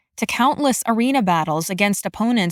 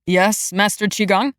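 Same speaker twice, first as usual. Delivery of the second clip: put-on young man voice. A narrator's reading. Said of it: "A masculine voice".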